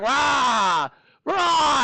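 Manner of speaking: hammed-up monster roaring. Teacher to class, imitating the monster with a loud mock roar. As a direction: roar like a stuck beast. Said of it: "He was like".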